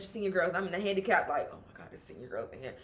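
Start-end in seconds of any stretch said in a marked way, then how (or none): 1.5-2.7 very low, quiet aside; under your breath, hiding from others; hushed thought. Retold or imitated. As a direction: voice dropped to a secretive murmur. Anxious inner thought in bathroom stall.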